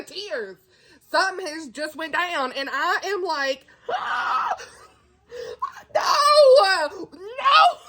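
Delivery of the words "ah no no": high anguished "Ah, no, no" with a laugh; real shock at a twist in her audiobook; shocked cry. Real, not acted. Vlogger gasping at a book twist, high wailing cry.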